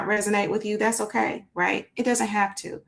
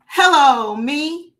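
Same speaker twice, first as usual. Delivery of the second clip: higher, louder showy title call; book title flourish; 6 semitones higher, 8 dB louder, much slower than usual. Acted. Host presents her children's book with a showy title call.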